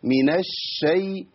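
الشي with a long, doubled sh; spreading sheen. Shown as a demonstration. Teacher shows the correct way, strong doubled sheen in الشي. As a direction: hold and spread the sh in الشي slowly. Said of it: "وبين التشديد بتاعها".

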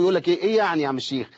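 usual speaking voice

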